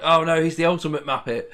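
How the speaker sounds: mock dread. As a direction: act out a fan's horror. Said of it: "You're just like".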